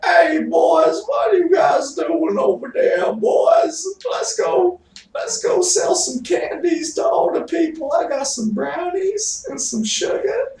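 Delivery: put-on very high, loud falsetto granny calling the boys; high loud old-woman falsetto; old lady voice. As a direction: shriek it like a cheerful old grandma. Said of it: "a really good old lady accent".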